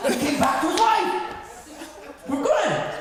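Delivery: high, giggling repeat of the line; laughing through the punchline. Real, not acted. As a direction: crack up as you say it again.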